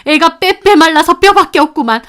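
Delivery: loud, high indignant retort; angry grandmother rebuttal. Acted. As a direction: scoff at the lie. Grandmother rebuts daughter-in-law.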